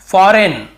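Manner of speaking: "फॉरेन" said without any g sound; silent g. Shown as a demonstration. Teacher models a word with silent G, correct pronunciation. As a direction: say फॉरेन loud, the g left silent. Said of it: "यहाँ पर भी जी silent है".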